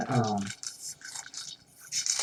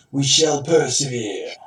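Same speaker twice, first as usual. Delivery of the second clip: loud, slow soldier voice; 3 semitones higher, 7 dB louder than usual. Pretend. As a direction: declare it like a proud soldier. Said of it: "my generic soldier voice".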